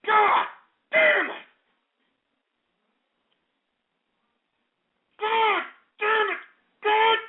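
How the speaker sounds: high, drawn-out furious cursing; wściekłe przeklinanie. Real, not acted. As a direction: curse at the top of your temper, word by word. Rage at prank callers.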